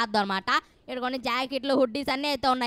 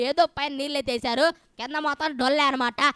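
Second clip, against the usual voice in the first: ordinary delivery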